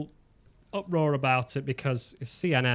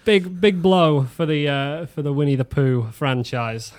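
out of breath; 9 dB louder than usual. Real, not acted. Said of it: "Catch your breath".

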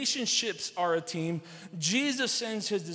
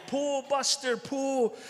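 higher, mildly hollered command; farmer's holler; 5 semitones higher, slower than usual. Retold or imitated. Farmer calling to a horse that won't move, mild holler. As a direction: holler to a horse, not full force.

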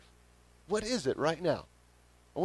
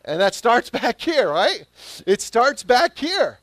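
emphatic preaching; 9 semitones higher, 9 dB louder than usual. Real, not acted. Preacher driving home his point.

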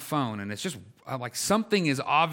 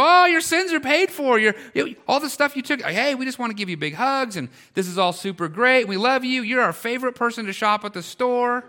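high, loud, hammed-up delight; mock gushing welcome; 9 semitones higher, 6 dB louder than usual. Preacher imagining store staff greeting the thief, mock overjoyed. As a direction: gush as if welcoming someone with open arms. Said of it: "embrace her with arms wide open".

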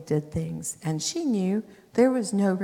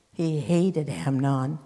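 usual speaking voice

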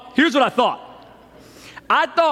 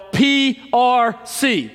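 slow, higher chanted reply; imitated yell; 4 semitones higher, much slower than usual. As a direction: answer like the stands, letter by letter. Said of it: "And we would yell".

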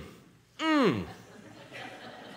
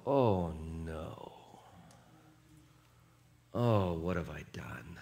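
mild dismay; 7 semitones lower, 7 dB quieter, slower than usual. Pretend. Realizing one's mistake, quoted.

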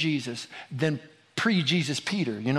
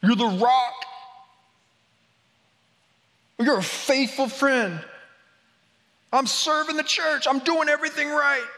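mock Peter; 9 semitones higher than usual. Peter bragging to Jesus, in a higher mock-proud voice. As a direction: puff up like a proud disciple.

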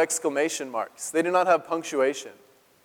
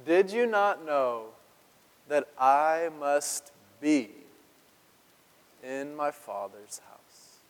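slow solemn; slower than usual. Acted. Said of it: "look at this response".

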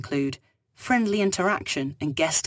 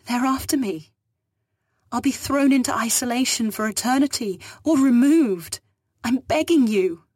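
higher, louder panicked begging; afraid; 8 semitones higher, 5 dB louder than usual. Acted. Hunted woman begs the captain, panicked and hurried. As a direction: panic choking the words. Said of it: "She sounded afraid".

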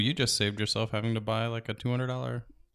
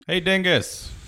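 loud, high shout to a voice assistant; yell; 9 semitones higher, 8 dB louder, slower than usual. Acted. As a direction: yell it out to summon your phone. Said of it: "I normally just yell".

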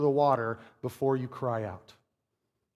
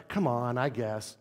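lower, softer reluctant concession; half-hearted invitation; 4 semitones lower, 6 dB quieter than usual. Shown as a demonstration. Not a command to Peter, half-hearted invitation. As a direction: give in half-heartedly, trailing down. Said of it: "Now, this is not a".

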